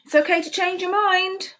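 bright, high storybook read; sing-song read-aloud for little kids; reading to children. A narrator's reading. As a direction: read it like a cheerful picture book. Reading picture book aloud.

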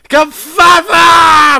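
very slow, high, loud yell; shouting. Real, not acted. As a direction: bellow the word out long. Shouting spell word.

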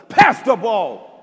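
slow, loud, drawn-out command; yelled order. Retold or imitated. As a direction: yell it at the whole team. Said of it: "I yelled".

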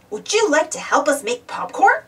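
ordinary delivery

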